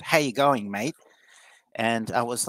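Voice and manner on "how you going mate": put-on Australian accent. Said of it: "didn't understand the Australian ochre accent".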